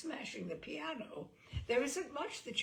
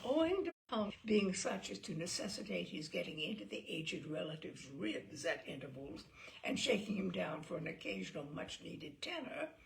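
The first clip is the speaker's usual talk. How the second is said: ordinary delivery, nothing marked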